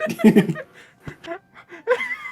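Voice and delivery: squealing laughter; high laugh. Real, not acted. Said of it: "laughing at the voice".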